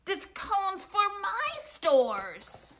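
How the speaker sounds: high, slow, emphatic claim; drawn-out squabbling holler; possessive shout. A narrator's reading. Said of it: "shouted Bruce".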